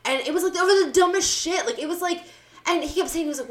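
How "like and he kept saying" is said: ordinary delivery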